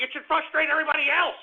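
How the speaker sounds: worked-up indignant complaint; heated frustration. Real, not acted. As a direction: push it out like you're still fuming. Heated rant before sighing.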